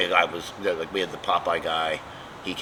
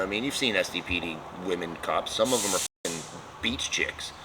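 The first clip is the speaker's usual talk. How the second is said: usual speaking voice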